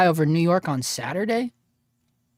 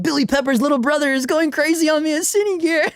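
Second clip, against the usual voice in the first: higher and louder, hammed-up alarm; mock panicked cry; 9 semitones higher, 4 dB louder than usual. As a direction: play the startled guy getting jumped. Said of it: "He'll be like".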